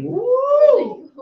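high whooping cheer; cheering on a donor's message; excited whoop. Real, not acted. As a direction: let out a big happy woo. Cheering a donation.

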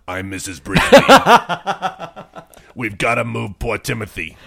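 slow, drawn-out actress impression breaking into laughs; hammed-up slow husky-star impersonation; Kathleen Turner impression. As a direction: do a drawling Kathleen Turner, cracking up. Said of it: "her natural voice is, uh, ha-, has an accent".

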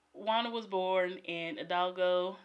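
ordinary talk

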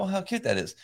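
lifted, sweet fond tone; doting delight; charmed, high and delighted. Retold or imitated. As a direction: gush over how cute it is. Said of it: "and I go".

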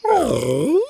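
high, crying whine; whining. Acted. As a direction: whimper like a sad dog. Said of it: "Buck whined".

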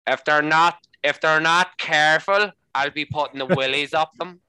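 imitated Belfast colleague's warning; Belfast accent. Irish guy at work warning about scaring people, in a Belfast accent. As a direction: do a broad mock-Belfast accent. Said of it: "he's from Belfast".

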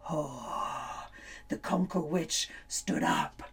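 hushed, breathy whisper. A narrator's reading. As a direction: whisper it in awe. Hushed before the witch rises.

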